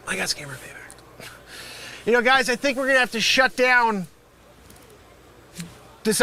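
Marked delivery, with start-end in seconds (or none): 0.1-1.3 defeated whisper. Acted. Dismayed realization.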